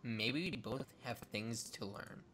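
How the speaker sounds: low Victoria voice. Acted.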